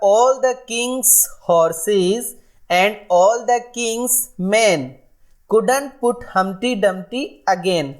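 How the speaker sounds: very slow, sing-song nursery rhyme recital; slow rhyme reading. Shown as a demonstration. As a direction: chant it out line by line for children. Reads nursery rhyme to class.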